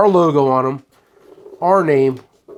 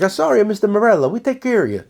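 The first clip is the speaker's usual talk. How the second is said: mock apology in a higher Italian accent; lilting, raised-pitch Italian-accent impression; 7 semitones higher, faster than usual. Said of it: "In an Italian accent".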